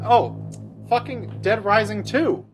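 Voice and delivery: usual speaking voice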